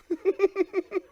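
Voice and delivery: loud, high burst of laughter; high amused laugh at the Wuhan pun. Real, not acted. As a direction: laugh at your own pun. Laughs at own joke.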